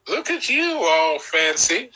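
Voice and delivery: high, slow, playful "Look at you, all fancy"; teasing copy of the commercial line; imitating the ad line. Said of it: "I like that".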